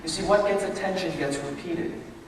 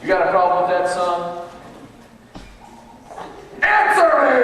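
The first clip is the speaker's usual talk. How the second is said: loud, much higher shouted demand; demanding dad; 3 semitones higher, 5 dB louder than usual. Pretend. Dad demanding son answer him, loud shout. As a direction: bellow for an answer.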